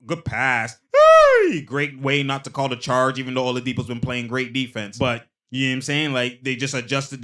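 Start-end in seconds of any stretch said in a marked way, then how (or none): 0.9-1.6 loud yelp. Real, not acted. Reacting to a play.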